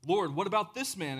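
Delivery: loud, high complaint; indignant protest. Acted. Peter asks Jesus about the other disciple, indignant. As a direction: plead the case with exasperation.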